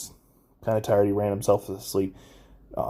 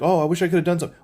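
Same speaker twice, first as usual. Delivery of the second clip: high, loud mocking of hollow regret; mock sympathetic friend; 8 semitones higher, 5 dB louder, much faster than usual. Mocking friends who looked away from him. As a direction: sneer out their empty regret. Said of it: "they're like".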